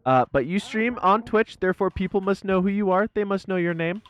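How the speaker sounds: emphatic insisting. Real, not acted. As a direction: press every point eagerly. Insists, pitch raised, emphatic.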